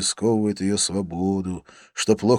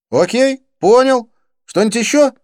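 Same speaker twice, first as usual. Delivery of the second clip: impatient; 10 dB louder than usual. A narrator's reading. Friend impatient to dash off.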